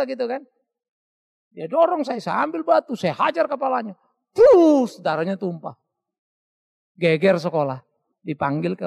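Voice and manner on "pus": loud sharp thwack; impact sound. Retold or imitated. Boy retells hitting the bully, loud impact noise. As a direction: smack out the hit.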